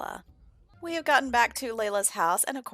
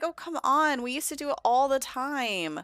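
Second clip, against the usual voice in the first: higher-pitched, coaxing protest; acted sibling pleading, pitched up; pestering sister; 7 semitones higher, 3 dB louder than usual. Sister pestering her reluctant brother.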